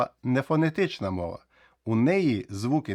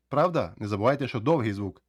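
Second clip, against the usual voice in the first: normal talking voice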